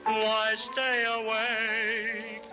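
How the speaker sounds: ordinary talk, nothing marked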